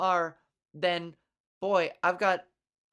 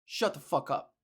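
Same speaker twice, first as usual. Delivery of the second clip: typical troll reply; 6 semitones lower than usual. Retold or imitated. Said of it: "your typical response, which would be like".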